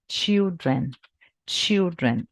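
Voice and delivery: put-on "Children" said carefully as CHIL-dren; children pronunciation demo. Shown as a demonstration. Teacher shows the plural of child to students, careful demo. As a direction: model "Children" twice, clearly. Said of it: "Muda totalmente a pronúncia".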